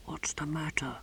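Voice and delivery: hushed, voiceless whisper. A narrator's reading. Novice secretly asking the tortoise in his box, whispering. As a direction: risk a whisper, barely audible. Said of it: "risked a whisper".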